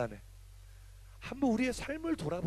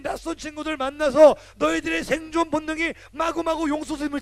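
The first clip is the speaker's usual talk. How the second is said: higher and louder, urgent warning; fired-up preaching; 7 semitones higher, 5 dB louder than usual. Acted. Pastor warns teens about school peer pressure, fired-up. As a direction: preach it hard, build the urgency.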